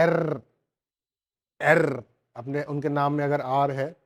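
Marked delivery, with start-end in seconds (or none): 0.0-2.0 एर said loud, German style; एर clipped and stressed. Shown as a demonstration. Teacher imitates native speakers for students, loud एर. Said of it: "वो ऐसे ही बोलते हैं".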